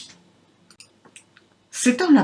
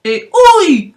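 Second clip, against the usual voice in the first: pained ouch; 9 semitones higher, 8 dB louder, faster than usual. Acted. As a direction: cry it out, loud and high. Loup tombe dans le puits. (cri de douleur aigu et fort)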